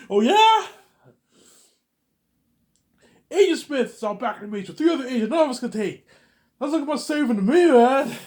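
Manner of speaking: high loud laughing yell; laughing shout. Acted.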